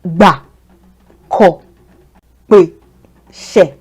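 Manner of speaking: "Ba, ko, pe, she" each low and falling. Shown as a demonstration. Teacher drilling low-tone syllables. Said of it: "They all align with the do tone".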